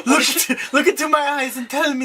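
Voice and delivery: high-pitched character mother; Penguin's mother. Acted. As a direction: call your son in a high mock-mother voice. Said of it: "his mother part".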